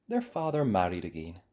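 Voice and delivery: plain reading voice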